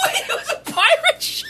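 high, laughing disbelief; laughing surprise. Real, not acted. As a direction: squeal it through laughter.

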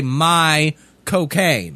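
slow Cockney accent "My cocaine". Shown as a demonstration. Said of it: "Right in the Cockney accent there".

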